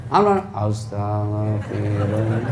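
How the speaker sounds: fake crying. Shown as a demonstration. Wife pretends to cry while praying istighfar, mock weeping. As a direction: pretend to weep as you say it. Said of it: "Sambil pura-pura menangis".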